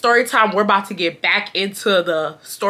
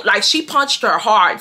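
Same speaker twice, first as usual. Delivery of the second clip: normal talking voice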